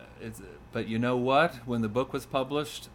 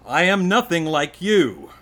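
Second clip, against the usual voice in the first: loud, high-pitched protest; indignant son; 9 semitones higher, 12 dB louder than usual. Acted. Son rejecting his father in a poem, indignant. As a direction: hurl it at a parent, acted. Poem voices son's angry outbursts.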